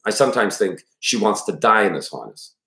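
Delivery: ordinary delivery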